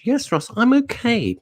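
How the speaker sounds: very high squeaky put-on voice; lightly voiced listener reply; mock listener reply. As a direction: answer as the listener in a silly falsetto.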